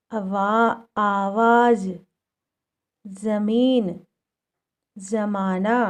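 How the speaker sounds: each word read slowly and clearly with a z sound; word list reading. Shown as a demonstration. Teacher reading nukta words aloud one by one. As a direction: dictate every word for the class to copy. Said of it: "अब हम इसे कैसे पढ़ रहे हैं".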